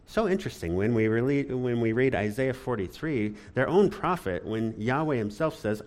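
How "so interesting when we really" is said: ordinary talk, nothing marked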